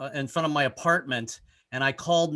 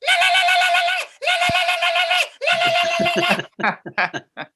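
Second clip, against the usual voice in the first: high shrieking laugh; 8 dB louder than usual. Real, not acted. Comic cracking himself up with a high shrieking laugh.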